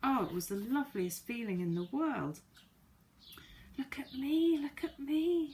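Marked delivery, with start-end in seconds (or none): 3.8-4.6 high breathy whisper of delight; girl flying marvels under her breath; excited whisper. Acted. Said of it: "she cried". 4.6-5.5 high voiced cry of delight; girl flying calls to friends; excited cry. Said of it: "she cried".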